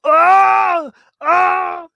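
high, loud mock agonized scream; mock pained screaming. Host acts out Ernie screaming during the mind read, hammed-up scream. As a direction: shriek as if your mind is being torn open.

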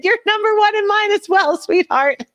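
mock sweet reply. Wife answering husband's teasing on the phone, mock sweet. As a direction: coo it back with a teasing sarcastic sweetness.

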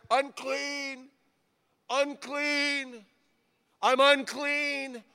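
high, drawn-out cry of an outcast leper. Acted. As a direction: call it out as a warning to passersby. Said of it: "telling people with as much of a voice as he could get".

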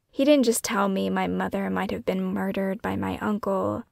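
plain reading voice

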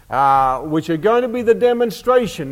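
normal talking voice

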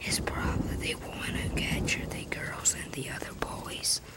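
hushed fearful whisper. Acted. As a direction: breathe it so no one hears. Boy whispers a suspicion.

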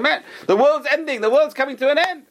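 high, loud panicked shouting; a frightened baby twin crying out that everything is over; screaming in panic. Acted. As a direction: yell it like the world is ending. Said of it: "He starts screaming and shouting".

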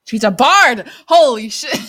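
loud startled outburst; shocked shout. Real, not acted. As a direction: blurt it out in disbelief. Player shocked at spell.

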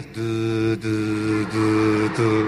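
low monotone scanning "tu, tu"; imitated scanner beeps. Wife scanning her husband on sight, low robotic beeps. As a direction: mimic a scanner on one low note. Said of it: "me empieza a escanear".

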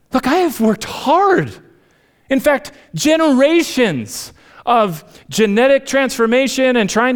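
put-on high, strained voice of a proud farm kid; anxious student. 4H student defending prize sheep.

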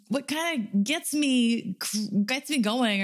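an odd, stray Caribbean-ish accent slipping out; weird accidental accent. Real, not acted. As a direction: let a strange half-Jamaican accent sneak in. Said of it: "in this weird non-Jamaican accent".

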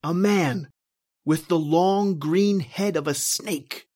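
ordinary talk

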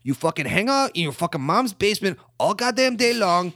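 loud, high, ranting fake Ukrainian accent. Ukrainian Ray ranting at mask-wearing hackers in a loud Ukrainian accent. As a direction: rant like an angry Ukrainian tough guy. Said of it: "in my Ukrainian accent".

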